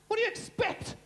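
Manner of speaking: high, indignant shrug of a line; exasperated inventor. Acted. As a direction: fire it back like it's obvious.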